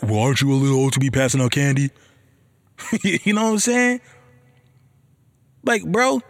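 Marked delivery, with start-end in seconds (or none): 0.0-1.9 quick, sneering echo thrown right back; mocking comeback. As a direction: mirror the neighbor's tone to mock him. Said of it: "I just wanna counter and be like".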